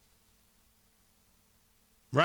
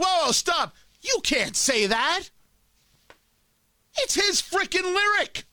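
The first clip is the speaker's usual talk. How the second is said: higher, mock-indignant halt, slowing on the middle line; rapper stopping her; 12 semitones higher, slower than usual. Rapper on stage scolding the singing girl, hammed-up indignant outburst. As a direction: cut her off like an outraged rapper. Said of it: "all of a sudden he's like".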